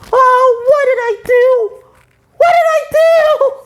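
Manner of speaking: hammed-up cartoon anguish; distraught SpongeBob. SpongeBob lamenting his mistake, high and loud. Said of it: "And then he goes".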